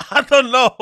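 amused laughing. Real, not acted. Laughing at the insults.

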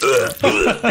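high mock gag, twice; acted retching. Character gags on a mouthful of blood, mock retching. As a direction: gag as if something foul got in your mouth. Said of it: "retches and gags".

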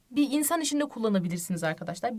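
ordinary talk, nothing marked

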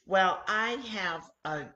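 usual speaking voice

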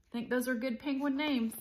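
ordinary delivery

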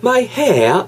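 hair with the R sound rolled in, North American style. Shown as a demonstration. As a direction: give hair a clear American R. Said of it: "북미권으로 가게 되면은 my hair".

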